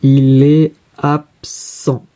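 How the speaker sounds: very slow, syllable by syllable; drawn-out, segmented teaching delivery; slow dictation. Shown as a demonstration. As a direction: separate each syllable of absent, as in dictation.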